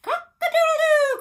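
very high, loud, long crow; rooster crow. A narrator's reading. Teacher reading the rooster's part, high loud crowing. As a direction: crow it out at full pitch. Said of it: "cried the rooster".

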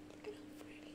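breathless whisper, no voice. Real, not acted. Fan whispering in awe at the video.

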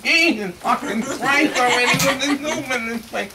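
high, loud, angry cry; exasperated outburst. Acted. Guest cutting off the waiter's soup pitch, exasperated.

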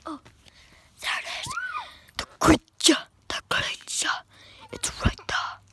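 hushed explorer whisper. Acted. Explorer sneaking up on the creature, whispering. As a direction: whisper so the creature won't hear.

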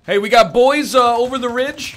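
loud, high warning shout; calling out. Real, not acted. Player spots boys over the ridge, shouted warning. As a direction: holler the sighting to your team. Calls out enemy sighting to team.

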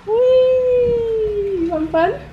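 high, gleeful squeal; playful whee. Real, not acted. Mum swinging her daughter, high and gleeful.

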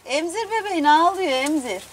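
high, soft pet talk; coaxing. Real, not acted. Owner telling mother animal to nurse, high soft coaxing.

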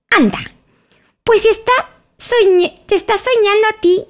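high, excited little twin voice, far above the narrator; gleeful twin. Acted. Tweedledee crowing at Alice, high and gleeful. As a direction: crow it like a cheeky twin who has just won.

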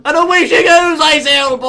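excited launch. Acted. Posh host cheering as his boat launches.

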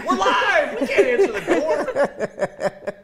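high, loud playful holler; mock yell. Co-host to a commenter supposedly outside, mock yell. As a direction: shout it through laughter to someone outside.

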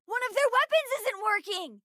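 high-pitched, eager report; excited battle callout. Acted. Child telling the pilot about a weapon hit, excited.

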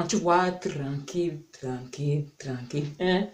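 put-on calm it down like soothing a child; gently. Shown as a demonstration. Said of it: "il faut faire ça tranquillement".

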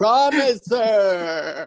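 slow, drawn-out cry; play-acted shout of betrayal; mock accusation. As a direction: wail it as a hammed-up insult.

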